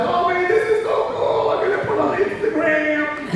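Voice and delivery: put-on very high, gushing teen voice; hammed-up high-pitched teen excitement; thrilled teenagers. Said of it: "all the teenagers".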